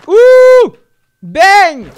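loud high whoop and bang; excited whoop. Real, not acted. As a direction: shout the shot out. Firing shot. (cri et bang forts)

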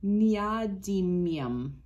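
neodymium said slowly, syllable by syllable, nee-oh-DIM-ee-um; corrected pronunciation. Real, not acted. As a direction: carefully sound out neodymium. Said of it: "I think I got that wrong".

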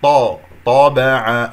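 طابع with a heavy ط and no lip rounding; correct demo. Shown as a demonstration. Teacher modelling the correct طابع slowly. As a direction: slowly say طابع, full ط, lips flat. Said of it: "لا إعمال للشفتين".

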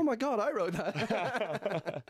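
slow amused surprise, laughing; mock astonishment with a laugh; surprised. Retold surprise at own song.

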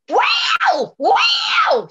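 very high, loud panther yowl; imitated panther scream. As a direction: screech like a wild cat close by.

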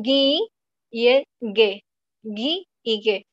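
"gui" y "ge" con g de guitarra y guerra; sonidos de g dura. Shown as a demonstration. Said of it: "así como guerra y guitarra". ("ge" suena gue, como en guerra)

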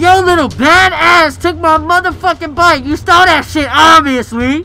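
loud, heated outburst over a stolen bike; angry shout. Acted. Gangster yelling at the kid who took his bike, angry shout. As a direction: blow up at the kid, voice pushed high and loud.

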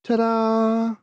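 high, sing-song reveal; playful ta-da. Acted. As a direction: present it with a flourish. Mother presents a bindi.

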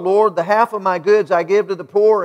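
normal talking voice

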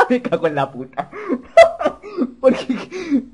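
high bursts of laughter breaking into the words; cracking up, barely getting the words out; laughing. Real, not acted. Player cracks up at the pajero line, laughing.

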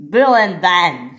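loud, drawn-out summons; shouted. A narrator's reading. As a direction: shout the names slowly to call them to account. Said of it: "shouted Topham Hatt".

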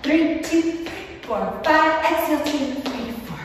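high, pumped count of breath reps; counting workout reps. Shown as a demonstration. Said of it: "I'm actually gonna count it out for you".

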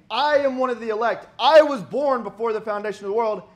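mock Calvinist boast. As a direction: play the proud elect bragging. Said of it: "They put the emphasis saying".

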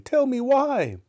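very high, loud frightened plea; trembling fear. Acted. Scrooge asking about the chains, trembling with fear. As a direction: beg for the reason, voice pitched high.

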